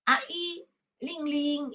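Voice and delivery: imitating broken, word-by-word speech; halting speech. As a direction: mimic someone struggling to get words out. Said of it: "dia mulai bisa berkata terpatah-patah".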